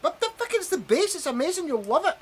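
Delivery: high-pitched, excited praise. Retold or imitated. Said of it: "me and Scott are like".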